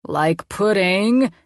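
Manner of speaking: very slow, playful menace; funny villain voice. A narrator's reading. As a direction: drag out the words like a mad scientist. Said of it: "in a funny voice".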